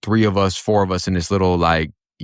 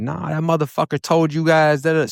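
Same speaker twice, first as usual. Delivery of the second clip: high, raised yelling at the guards; furious yell in the holding area, much higher; retold angry shout; angry scream; 9 semitones higher than usual. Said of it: "I started screaming".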